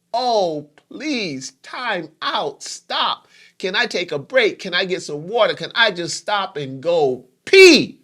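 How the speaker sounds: high, overwhelmed pleading, building to a loud last word; exasperated plea. Acted. Caregiver swamped by hospital information, begging for a break, exasperated.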